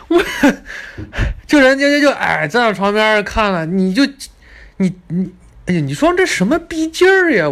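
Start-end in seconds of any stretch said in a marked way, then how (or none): 0.2-1.4 squeaky unvoiced burst of laughter; high breathless laugh. Real, not acted. Laughing at what the guide told the short lady, high wheezy laugh. 2.7-7.5 much higher, louder incredulous cry; exasperated outburst. Real, not acted. Telling viewers about the rude guide, incredulous high outburst.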